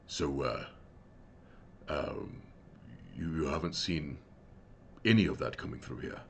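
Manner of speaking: a lightly voiced large man, questioning quietly; large man's voice. Game master voices the large man questioning Scosset, low and soft.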